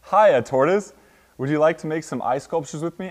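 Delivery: high, upbeat hail, loud at the start; eager invitation. A narrator's reading. Rabbit inviting Tortoise to make ice sculptures, eager. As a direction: pipe up like a keen playmate.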